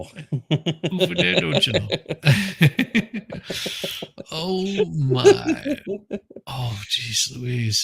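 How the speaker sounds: slow Minnesotan "off the dare", laughing at the end; Minnesotan accent. Shown as a demonstration. Host echoing the Minnesotan accent through laughter. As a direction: drawl it out Minnesota style, cracking up. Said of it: "Minnesota accent".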